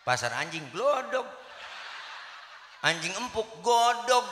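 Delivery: rising comic crescendo, last word shouted high; building comic punchline. Acted.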